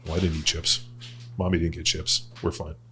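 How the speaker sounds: calm the disappointed son down; gentle reassurance. Retold or imitated. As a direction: mom softly reassuring her son he's fine. Mom comforting son about chips.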